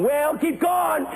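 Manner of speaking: high, drawn-out urging call; imitated congregation shout. Congregation answering the pastor, imitated shout. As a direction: call out long and high to spur him on. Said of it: "We were going".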